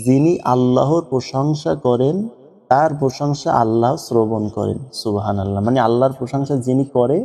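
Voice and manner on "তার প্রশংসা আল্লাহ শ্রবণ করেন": ordinary talk, nothing marked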